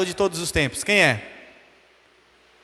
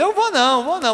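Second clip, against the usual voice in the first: quick high-pitched refusal; frightened child; 7 semitones higher than usual. Retold or imitated. Son refusing his angry mother out of fear, high voice. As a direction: back away from the summons, scared. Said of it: "Aí eu já ficava com medo".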